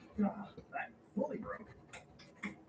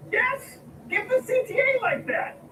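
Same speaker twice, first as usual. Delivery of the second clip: higher, louder exasperated shout; exasperated yell; 13 semitones higher, 11 dB louder than usual. Acted. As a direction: shout it like a fed-up boss. Boss demanding.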